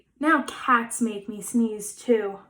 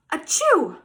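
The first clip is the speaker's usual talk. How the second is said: sneeze; 3 semitones higher than usual. Acted. Gerald sneezes on pigs.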